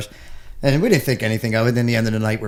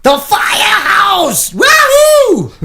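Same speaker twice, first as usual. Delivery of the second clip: very high, slow whooping cheer; excited cheer; 5 dB louder, much slower than usual. Pretend. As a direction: cheer it like a rock crowd.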